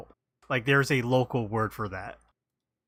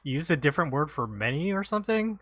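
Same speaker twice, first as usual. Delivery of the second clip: confused; 6 semitones higher than usual. Retold or imitated. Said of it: "You're like".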